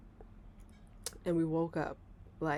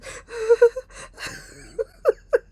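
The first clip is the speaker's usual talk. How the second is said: high, loud frightened whimpering; mock terrified gasps; 8 dB louder than usual. Scared girl at breakfast table, mock terrified whimper. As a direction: act out shaking with fear in gasps. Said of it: "I'm at the table like this".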